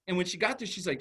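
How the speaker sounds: ordinary delivery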